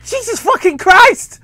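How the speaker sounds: high, slow outburst; exasperated exclamation. Real, not acted. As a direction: cry it out in exasperation.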